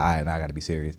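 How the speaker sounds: gathering herself after laughing, quietly; mock composing self. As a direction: pull yourself together under your breath. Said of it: "gather myself and be like".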